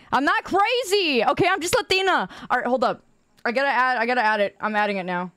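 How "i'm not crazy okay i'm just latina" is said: high, slowed protest; playfully defensive and high; mock-indignant denial. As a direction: insist it in a raised pitch. Comic denial of being crazy.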